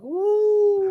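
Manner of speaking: high-pitched imitated wail of dismay; mock grief. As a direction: moan as if bereft, hammed up. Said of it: "Like somebody killed his dog".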